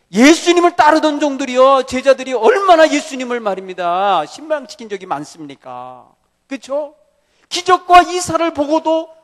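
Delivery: full-voiced pulpit preaching; fervent preaching. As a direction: drive the story home.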